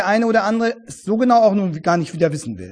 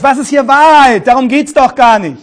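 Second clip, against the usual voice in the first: louder, higher irritated outburst; angry; 5 semitones higher, 10 dB louder than usual. Shown as a demonstration. Pilate answering Jesus, loud and angry. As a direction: snap the question back, annoyed. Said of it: "Oder vielleicht fragt er das ärgerlich".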